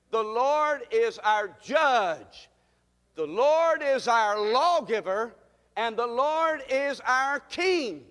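loud, drawn-out call for the congregation to repeat; rallying pulpit declaration; preaching at full force. Real, not acted. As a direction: proclaim it slowly, leaning on the key words.

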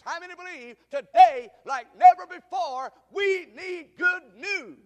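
loud, high, rising preacher's call; preaching at full force. Real, not acted. Preacher to the congregation, loud and rising. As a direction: lift the crowd toward the good news.

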